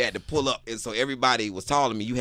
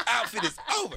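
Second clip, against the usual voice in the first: laughing; 13 semitones higher than usual. Real, not acted. Laughing repeat of punchline.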